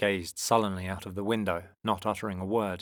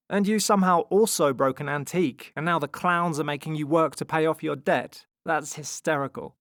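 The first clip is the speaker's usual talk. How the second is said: loud, raised-pitch ribbing; teasing brother; 8 semitones higher, 6 dB louder than usual. A narrator's reading. Tom mocks the children's debt, higher and teasing. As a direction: rub it in, amused.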